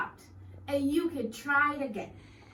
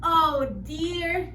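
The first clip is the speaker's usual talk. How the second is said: worried; 5 semitones higher, 6 dB louder than usual. Acted. Worried bird talks to himself.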